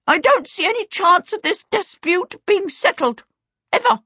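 high, indignant little creature; indignant kobold. A narrator's reading. Kobold giving up on the dispute, indignant. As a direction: declare it as an affronted kobold.

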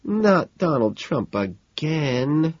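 slow, high, exasperated moan. A narrator's reading. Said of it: "Evelyn moans".

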